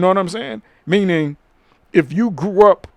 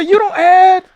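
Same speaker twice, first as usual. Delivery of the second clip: mock indignant stranger; 9 semitones higher, 6 dB louder than usual. Stranger argues back.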